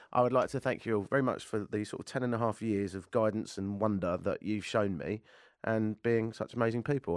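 ordinary delivery

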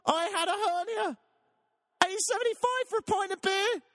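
rising falsetto rant about a ten dollar pint; high angry voice. Real, not acted. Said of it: "I've gone high voice".

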